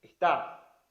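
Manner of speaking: showing the accent on está; stressed word. Shown as a demonstration. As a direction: hit "está" on its accented syllable. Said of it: "el acento está".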